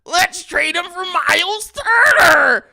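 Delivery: high, slow crying shout; whining fan. Acted. Bulls fan answering who to trade for, mock wailing. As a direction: wail the demand in a mock-fan voice. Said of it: "Whining ass Bulls fans".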